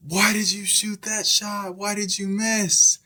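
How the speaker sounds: very high, strained mock yell; yelling coach. Upset coach to player who airballed, yelling. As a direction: berate a player like an irate coach. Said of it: "He's yelling at him".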